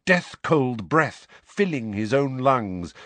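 ordinary delivery

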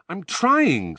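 high-pitched exasperated retort; angry protest. A narrator's reading. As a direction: snap back in frustration. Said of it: "said Harry angrily".

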